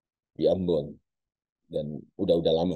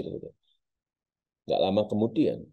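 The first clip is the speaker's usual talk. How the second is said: normal talking voice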